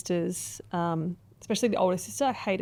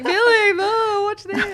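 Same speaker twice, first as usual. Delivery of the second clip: high, loud mock-weepy wail; retold tearful cry; 13 semitones higher, 6 dB louder, slower than usual. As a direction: wail it out as if crying over a video. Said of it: "I watched it and I was crying".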